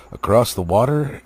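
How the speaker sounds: ordinary delivery, nothing marked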